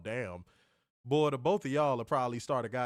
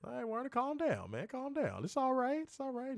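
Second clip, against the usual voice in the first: high, soft calming voice; mock soothing; 11 semitones higher, 5 dB quieter than usual. Host calming co-host who got hot, mock soothing. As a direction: talk them down in a high, soft voice.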